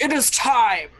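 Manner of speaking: formal, commanding announcement; lightly voiced guard; solemn soldiers. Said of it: "They're just like".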